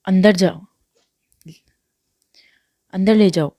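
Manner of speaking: loud, curt command; father curtly orders son inside, loud and flat. A narrator's reading. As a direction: bark it out with no feeling. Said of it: "spot سے کہا".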